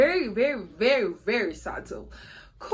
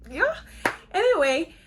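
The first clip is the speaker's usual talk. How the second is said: ordinary talk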